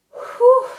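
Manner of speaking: high, loud exhale whew; breathless whew. Real, not acted. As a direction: blow out a big breath. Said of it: "I am so out of breath".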